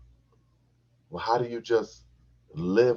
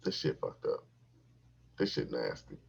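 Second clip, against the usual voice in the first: low under-breath grumble; muttered disgust; 7 semitones lower, 5 dB quieter than usual. Retold or imitated. His inner thought during the act, quiet disgust. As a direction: mutter it to yourself, grossed out. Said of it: "I'm like".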